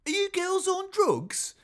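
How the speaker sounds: very high, loud English caricature; mock English accent. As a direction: squawk it as an over-the-top English bloke.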